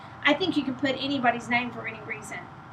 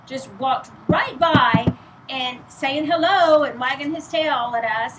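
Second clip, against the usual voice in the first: usual speaking voice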